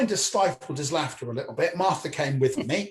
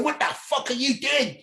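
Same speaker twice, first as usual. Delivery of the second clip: high-pitched outraged wife impression; wife's angry voice; 7 semitones higher than usual. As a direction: mimic an indignant wife in a raised pitch. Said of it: "she does speak like that".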